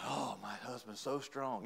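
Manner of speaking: soft, slow mock swoon; admiring wife. Wife to husband opening pickles, mock-admiring. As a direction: coo it quietly, drawn out.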